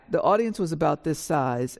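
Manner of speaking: normal talking voice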